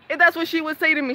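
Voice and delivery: normal talking voice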